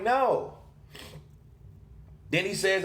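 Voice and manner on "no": sharp, much higher, disbelieving no; incredulous no. Retold or imitated. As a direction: fire back a flat refusal, pitch jumping up. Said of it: "I'm like".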